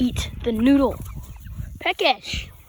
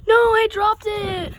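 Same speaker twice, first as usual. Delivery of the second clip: high, slow dismayed cry; dismayed gasp; 9 semitones higher, 8 dB louder, slower than usual. Real, not acted. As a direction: gasp at the dropped noodle. Gasps at dropping noodle.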